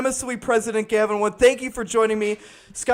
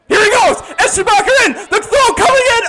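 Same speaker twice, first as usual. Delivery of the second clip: rising shout as the runner heads home; excited call; 7 semitones higher, 5 dB louder than usual. Real, not acted. Announcer yelling as the runner breaks for home. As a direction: call the play at the plate at full voice. Play-by-play of close play at plate.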